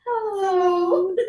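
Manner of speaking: high, loud sing-song greeting; squeaky little-kid greeting; imitating toddler hello. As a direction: call hello like an excited tiny child.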